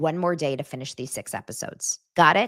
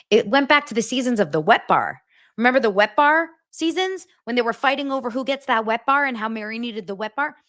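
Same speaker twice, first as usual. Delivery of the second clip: ordinary talk, nothing marked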